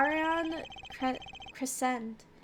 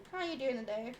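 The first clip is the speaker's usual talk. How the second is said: usual speaking voice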